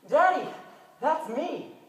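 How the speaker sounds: put-on high, loud little boy calling out; small child voice. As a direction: pipe up eagerly like a young child. Son tugs father's jacket.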